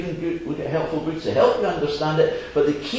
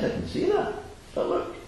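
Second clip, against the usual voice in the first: high, softer fisherman voice; 7 dB quieter than usual. Acted. Fisherman answering the girl, high and soft. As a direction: gently point it out. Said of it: "He says".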